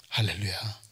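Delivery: 할렐루야 said softly and low; soft hallelujah. Acted. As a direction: murmur 할렐루야 quietly. Soft hallelujah after whisper. (할렐루야를 낮고 조용하게)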